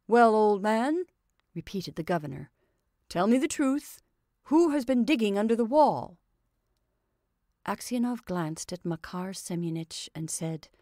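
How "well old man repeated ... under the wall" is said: governor. A narrator's reading. Governor interrogates the old prisoner.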